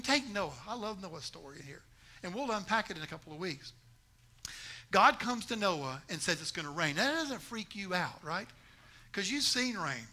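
normal talking voice

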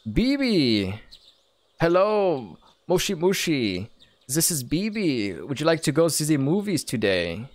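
put-on character voice in a German-sounding accent; lilting, muddled French-German accent; French German man. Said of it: "a French German man".

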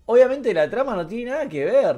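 ordinary delivery

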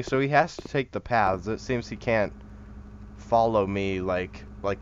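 ordinary talk, nothing marked

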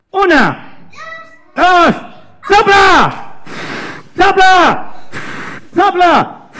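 rousing high-pitched orders; boss ant's command. Acted. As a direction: yell each order as one big burst. Boss ant counts, orders blowing.

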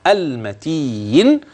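"المتين" very slowly, light letters and no nasal n; stretched "المتين" with a clean n. Shown as a demonstration. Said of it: "الحروف مرققة والنون لا غنة".

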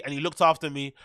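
usual speaking voice

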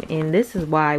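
ordinary delivery

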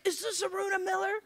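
high, quick imitated caller's question; tentative caller. The same caller ringing back, imitated high and quick, milder. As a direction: ask high and fast, without shouting.

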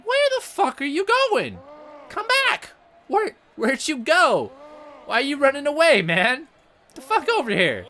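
high-pitched, indignant hollering; calling after opponent. Pretend. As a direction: call out to someone running away, in mock outrage. Calling after fleeing enemy.

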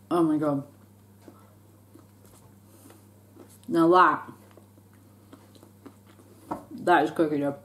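chewing while talking; talking between bites; mouth full. Real, not acted. Seen 4: Puts pieces in mouth, chewing visible through about 10s.

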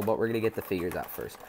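normal talking voice

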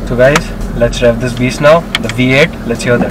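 loud, eager hype; pumped up for the engine; excited. Real, not acted. About to rev the V8.

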